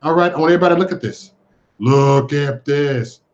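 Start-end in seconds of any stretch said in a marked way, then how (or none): 1.8-3.2 very slow, lowered delivery; drawn-out presenting. Real, not acted. Host showing everybody the video, slow and drawn out. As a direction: stretch every word out for the reveal.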